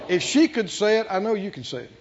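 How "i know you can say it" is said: usual speaking voice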